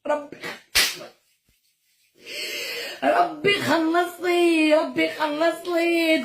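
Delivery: slow, high, tearful plea; angry crying. Real, not acted. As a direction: wail it slowly, near sobbing. Pleading to God, repeated.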